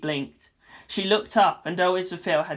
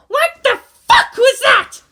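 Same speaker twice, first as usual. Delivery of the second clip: very high, louder, slow outraged yell; furious yell; 11 dB louder, slower than usual. A narrator's reading. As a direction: scream at him. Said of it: "Asuka yelled".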